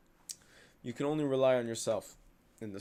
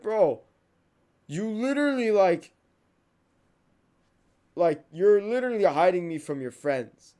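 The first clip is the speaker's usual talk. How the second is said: raised, higher voice calling out a friend; heated confrontation; 5 semitones higher, 7 dB louder than usual. Acted. Confronting a friend about being hidden from his friends, heated. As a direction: accuse him with exasperated force.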